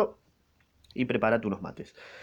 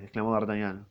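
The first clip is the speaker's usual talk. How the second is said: ordinary delivery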